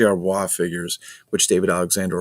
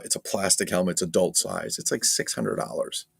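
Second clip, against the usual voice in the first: ordinary talk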